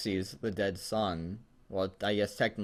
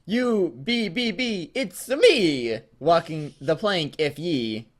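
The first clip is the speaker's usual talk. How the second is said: high, stuttering pirate robot voice; a broken animatronic pirate stammering; 6 semitones higher, 5 dB louder, much slower than usual. Acted. Foxy pirate voice again.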